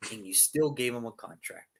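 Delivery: ordinary talk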